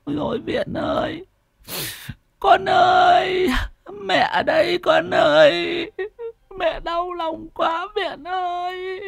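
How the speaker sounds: very high, drawn-out keening cry; tearful wailing. A narrator's reading. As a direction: wail like a grieving mother calling her child. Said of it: "thì mếu máo".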